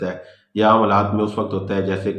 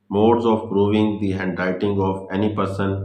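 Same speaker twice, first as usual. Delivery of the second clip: very slow, word by word, as if dictating notes; slow heading read; much slower than usual. Shown as a demonstration. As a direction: spell out the heading slowly so students can write it. Reading out a heading slowly. (धीरे धीरे शीर्षक लिखवाना)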